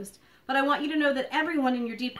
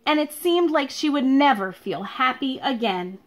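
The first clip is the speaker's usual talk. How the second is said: louder, lively storybook read-aloud; reading to children; 9 dB louder than usual. Acted. Teacher reading a picture book to her pupils, lively storytelling. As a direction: read it to a class of little kids, bright and clear.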